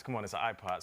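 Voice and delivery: ordinary talk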